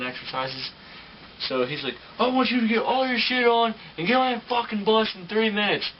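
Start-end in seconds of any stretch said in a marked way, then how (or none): 2.2-5.9 much higher and louder barked order; drill sergeant yell. Retold or imitated. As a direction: bellow it like a drill sergeant on a deadline. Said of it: "started yelling at us".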